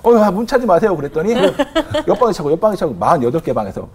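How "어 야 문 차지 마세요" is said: high-pitched retold appeal to inmates; imitated rookie guard losing control; flustered call. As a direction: call out politely but urgently. Rookie guard calling to kicking inmates.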